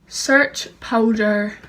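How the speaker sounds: very slow, drawn out command; repeating to voice assistant. Real, not acted. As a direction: stretch each word for the machine.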